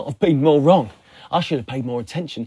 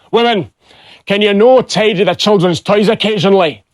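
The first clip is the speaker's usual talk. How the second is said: loud, higher-pitched Scottish grumble; moaning Scottish husband; 5 semitones higher, 6 dB louder than usual. Acted. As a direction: moan at your wife in broad Scots. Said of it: "while moaning at his wife".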